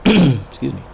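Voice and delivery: throat clear, then slightly hoarse. Real, not acted. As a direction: voice worn out from talking. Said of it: "You start to lose your voice".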